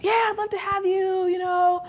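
high, eager welcome; lightly voiced eager self; eager reply. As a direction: gladly accept the visit. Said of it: "her eager reply".

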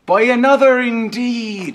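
high, loud ominous agreement; dramatic. Acted. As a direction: say it like a portentous revelation.

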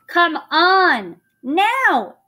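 high, loud call; urgent yell from afar; impatient shout. A narrator's reading. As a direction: shout to hurry them along. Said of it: "Annie was shouting".

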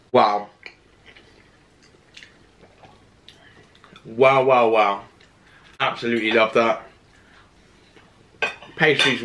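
chewing between words; talking mid-bite; mouth full of food. Real, not acted. Seen 3: Repeatedly forks food into mouth and chews while speaking throughout.

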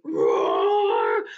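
short burst of roaring; dinosaur roar. Acted. Storyteller does all moves at once for kids, roar. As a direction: break out in a playful roar. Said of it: "and roar".

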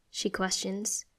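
plain reading voice

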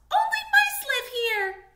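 tiny squeaky high mouse voice; little mouse. A narrator's reading. Mouse guarding its home from a stranger, high squeak. Mice turning Harriet away.

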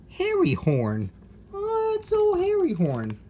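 put-on high, delighted hello to an old friend; delighted greeting. Unboxer recognizing a toy, delighted.

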